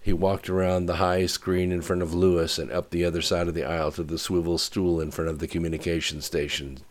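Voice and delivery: plain reading voice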